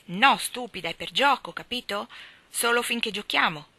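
put-on cross child, impatient and emphatic; angry scolding. A narrator's reading. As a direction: tell off a silly playmate. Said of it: "era molto arrabbiato con lei".

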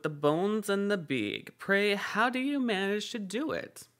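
put-on young man voice. A narrator's reading. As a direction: raise the pitch for the youth.

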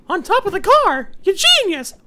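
usual speaking voice

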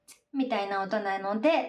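ordinary delivery

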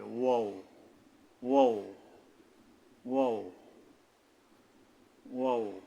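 ওয়াও made between slightly rounded lips. Shown as a demonstration. Teacher repeats the letter for learners, round-lipped ওয়াও. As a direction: round both lips a little for each ওয়াও. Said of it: "ঠোঁট দুইটা কিছুটা গোল হয়ে যাবে".

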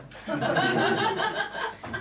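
a high, bursting laugh at own joke; high cackle. Real, not acted. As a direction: crack up at the punchline.

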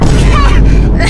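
high burst of laughter; loud laugh. Real, not acted. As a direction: cackle out loud. Laughing at leaving dog idea.

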